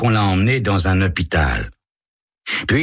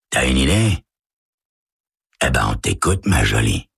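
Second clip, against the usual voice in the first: condescending crook; 5 semitones lower than usual. Acted. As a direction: humor the girl, low. Howie mocks Carole.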